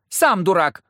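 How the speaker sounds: put-on high, loud child's comeback; childish retort. A narrator's reading. Girl answers the leader's insult, loud and indignant. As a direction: fire back like an insulted little girl.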